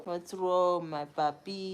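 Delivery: normal talking voice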